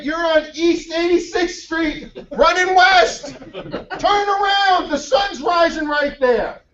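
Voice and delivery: high, drawn-out, incredulous correction; imitating an old man yelling directions; exasperated mock shout. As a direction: spell it out slowly at a very high pitch. Old man sets the runner straight.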